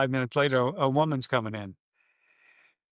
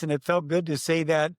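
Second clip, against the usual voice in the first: normal talking voice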